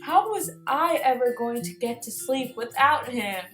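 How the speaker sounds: ordinary delivery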